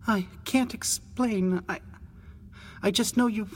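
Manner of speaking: high, halting adolescent voice; tearful boy. A narrator's reading. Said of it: "Tears crept down his flushed face".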